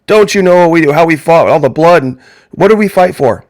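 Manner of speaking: a hammed-up patriot demanding answers; mock indignant commenter. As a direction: play the offended commenter hammering back. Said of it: "a whole bunch of people typing already".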